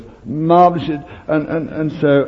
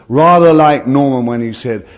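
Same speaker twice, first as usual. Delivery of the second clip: normal talking voice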